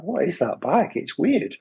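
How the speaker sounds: high-pitched, puzzled question; curious onlooker. Retold or imitated. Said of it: "their curiosity was like".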